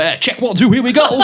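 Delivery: loud, high MC hype shout; hammed-up mic check over a party track; mock MC. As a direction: burst in like an overexcited club MC. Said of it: "someone going there".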